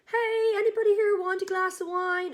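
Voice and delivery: high, loud call across the tables; chirpy waitress call. Retold or imitated. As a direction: sing it out like a cheery waitress offering wine. Waitress offering wine to guests.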